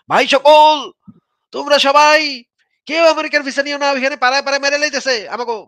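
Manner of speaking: high, loud mock crowd appeal; mock public appeal. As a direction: harangue a crowd in mockery. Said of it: "গিয়ে কেন বলেন না যে".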